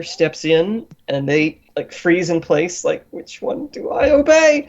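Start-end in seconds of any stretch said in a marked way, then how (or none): 3.1-4.7 high-pitched, torn underling; comically flustered, pitched right up; panicked dithering. Acted. Assassin frozen between two masters, squeaky panic.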